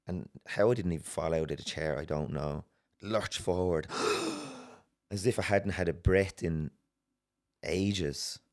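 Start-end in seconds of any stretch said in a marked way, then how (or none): 3.9-4.6 re-enacted huge gasp for air; deep gasp. Coming out of the trance, re-enacted deep gasp. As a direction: suck in breath as if surfacing. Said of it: "it's just the deepest breath".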